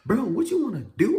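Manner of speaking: high, loud squaring up; retold challenge. Calling out the other fighter, loud high challenge. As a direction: call them out at high pitch.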